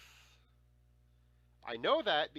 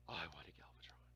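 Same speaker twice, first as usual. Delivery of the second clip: soft unvoiced whisper of longing; 8 semitones lower, 13 dB quieter than usual. Real, not acted. Telling viewers the childhood wish in a hushed whisper. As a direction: breathe it like a secret wish.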